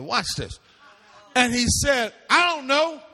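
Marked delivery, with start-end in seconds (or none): 2.3-3.0 sullen, brushing-off reply; a higher, defiant Cain shrugging God off; Cain's dismissive answer. Acted. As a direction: toss it off like you don't care. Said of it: "That's exactly in the Hebrew".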